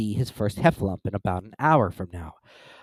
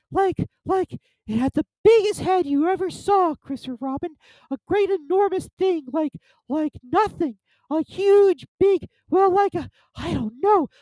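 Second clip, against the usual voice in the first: very high, flustered little voice, groping for words; Piglet. A narrator's reading. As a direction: describe it in awe, tiny and squeaky. Piglet describes the monster.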